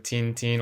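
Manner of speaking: put-on Tintin as "teen-teen", n's sounded; Tintin pronounced "tin-tin", not nasalised French; English-style pronunciation. Shown as a demonstration. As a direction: say Tintin with ee-like vowels in both halves. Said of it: "if you pronounce it".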